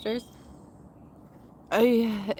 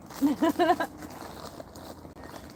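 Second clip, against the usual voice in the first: breathy laugh; 9 semitones higher than usual. Real, not acted. Breathy laugh after eating remark.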